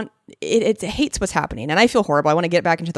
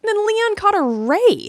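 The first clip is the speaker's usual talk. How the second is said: higher, louder excited announcement; 14 semitones higher, 4 dB louder than usual. Real, not acted. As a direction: announce it with a bright jolt.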